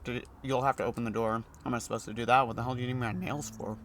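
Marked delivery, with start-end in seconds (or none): none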